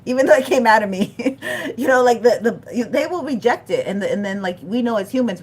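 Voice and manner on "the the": ordinary talk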